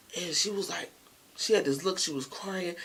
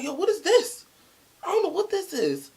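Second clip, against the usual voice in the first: high-pitched, alarmed denial; retold panicky bewilderment; acted bewildered protest; 11 semitones higher, 5 dB louder than usual. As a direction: protest loudly, as if caught off guard. Acts out man confronted with tapes.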